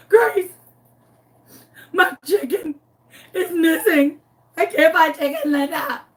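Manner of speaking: high-pitched wailing into the phone; hammed-up crying. Calling empathetic Grace for comfort, mock crying. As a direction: sob it out in pieces, overacted. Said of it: "I am crying my eyes out, crying".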